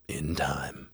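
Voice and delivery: low, soft mock-demon reply; cool demonic refusal. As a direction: answer softly and low, in no hurry. Retelling demon's first cool refusal.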